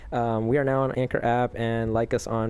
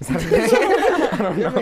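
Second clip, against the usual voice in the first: much higher, louder laughing; 7 dB louder, faster than usual. Real, not acted. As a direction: break up laughing mid-question. Cracks up at oven baked.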